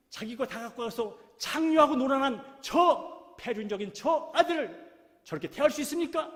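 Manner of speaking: put-on older son confronts his father in rising fury; furious protest. A narrator's reading. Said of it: "화가 단단히 나가지고".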